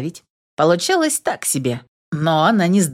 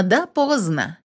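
usual speaking voice

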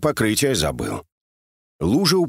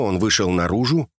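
usual speaking voice

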